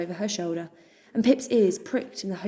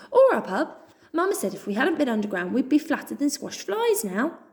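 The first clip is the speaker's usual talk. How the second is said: gossiping quickly; 6 semitones higher than usual. Acted. Joe gossiping to Pip, high and eager. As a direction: rattle off the news.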